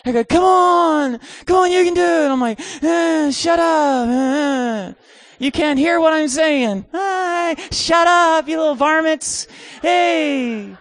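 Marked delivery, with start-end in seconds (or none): 0.2-2.3 high-pitched imitation of calling from below; distant cheering crowd. Young people on the ground urging him, mock distant calling. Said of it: "They're so far away". 2.8-10.7 high, drawn-out cranky calling down; mock irritated holler. Preacher on platform answering tiny campers, mock irritated holler.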